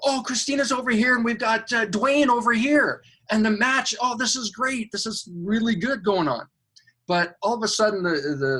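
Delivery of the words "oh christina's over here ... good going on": excited angel. Retold or imitated. Supervisor and angels voiced.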